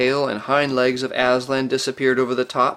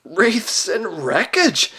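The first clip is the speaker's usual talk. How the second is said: high, slow, gasping exclamation; aghast, voice pitched up; frightened gasp; 8 semitones higher, much slower than usual. A narrator's reading. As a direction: gasp it out in terror. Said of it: "gasped Trumpkin in the ghost of a".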